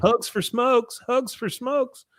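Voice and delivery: high, drawn-out calling voice; singsong imitation of a girl hawking; hippie girl. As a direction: call it out like a vendor, slow and high. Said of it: "this hippie girl comes through and says".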